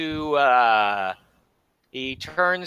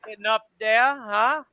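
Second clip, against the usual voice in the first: higher, jovial French bayou host; Cajun bar owner; 5 semitones higher, 4 dB louder than usual. Acted. Bar owner welcomes the hungry party to sit anywhere. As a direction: play the genial bayou cook.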